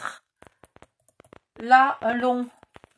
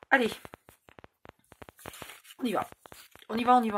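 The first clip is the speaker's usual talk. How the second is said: ordinary talk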